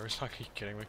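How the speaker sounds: ordinary talk, nothing marked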